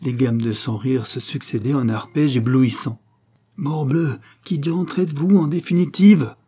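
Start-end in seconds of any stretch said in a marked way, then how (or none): 3.6-6.4 high, loud angry demand; vociferating. A narrator's reading. Exasperated husband confronts Mr Gibson, shouting angrily. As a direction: shout it right in his face, building. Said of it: "vociféra Guérac au nez de monsieur Gibson".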